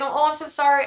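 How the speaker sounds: light mock high apologetic voice; a hint of the sheepish manicurist; mimicking apology. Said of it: "I'm not gonna do an accent".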